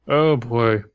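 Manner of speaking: ordinary talk, nothing marked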